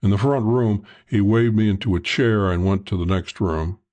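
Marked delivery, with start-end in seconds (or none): none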